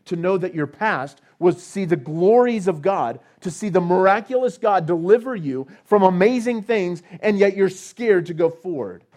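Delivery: loud, high, driving sermon delivery; preaching at full force. Acted. Preacher to his congregation about fear, preaching at full force. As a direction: thunder it out to the congregation.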